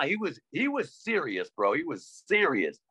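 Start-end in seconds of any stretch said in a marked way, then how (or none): none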